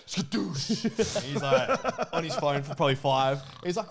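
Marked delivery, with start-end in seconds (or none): 0.1-0.8 high, loud "skadoosh" burst; explosive sound effect of the seat dropping; mimicked seat slam. Teasing a friend about slamming his seat back, mimicked slam. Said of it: "He just hits it hard and just goes".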